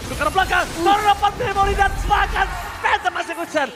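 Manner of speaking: rising excited esports call; hype play-by-play. Acted.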